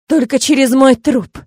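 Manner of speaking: slow, forceful female outrage; full of fury. A narrator's reading. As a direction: stand your ground furiously, stretching the words. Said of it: "раздался полный ярости женский голос".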